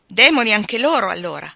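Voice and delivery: loud, high outburst of rage; furious shout. A narrator's reading. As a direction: lose control and yell it. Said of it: "ho gridato io in preda alla rabbia".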